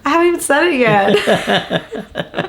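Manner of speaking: slow, amused and laughing; laughing protest. Real, not acted. Teasing her co-host, laughing through it.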